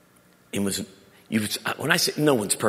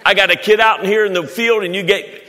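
loud, high fiery preaching; preaching at full force; 9 semitones higher, 10 dB louder than usual. Acted. Preacher booming to the congregation, fiery. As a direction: preach it out to the crowd.

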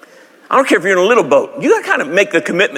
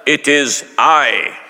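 solemn King James Jesus; 2 semitones lower, much slower than usual. Pretend. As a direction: intone it like an old Bible film. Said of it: "I didn't say that".

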